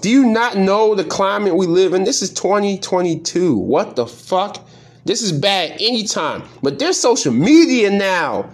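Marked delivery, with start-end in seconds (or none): none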